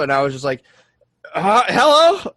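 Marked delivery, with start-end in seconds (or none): 1.3-2.3 loud, high rising hello; ridiculous blurted greeting. Retold or imitated. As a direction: blurt it high, as the first thing that comes out. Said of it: "it sounds so ridiculous".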